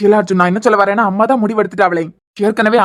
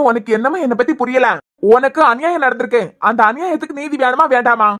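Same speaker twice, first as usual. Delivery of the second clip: ordinary talk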